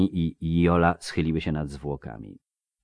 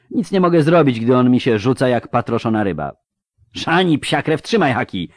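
urgent, raised surgeon's voice; harried field surgeon; 5 semitones higher, 6 dB louder than usual. Acted. Surgeon pressing helpers over a thrashing patient, urgent. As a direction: give orders mid-operation, higher and louder.

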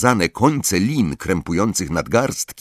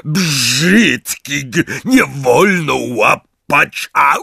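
high, slow yell cut by pain; scolding yell; 7 semitones higher, 4 dB louder, much slower than usual. A narrator's reading. Hagrid scolding the giant and getting hit, yelling. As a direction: bellow a rebuke, then yelp. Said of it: "wrzeszczał Hagrid".

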